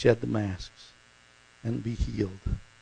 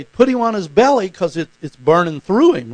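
loud, high medic's order; urgent call; 6 semitones higher, 10 dB louder than usual. Retold or imitated. Medic shouting to carry the wounded soldier on his belly, loud urgent order. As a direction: call it out urgently to the stretcher crew. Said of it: "they say".

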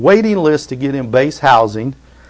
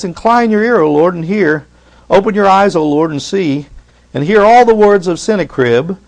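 raised, earnest prayer voice; fervent prayer reading; 4 semitones higher, 4 dB louder than usual. Acted. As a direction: plead the scripture prayer aloud. Reading Hezekiah's prayer aloud.

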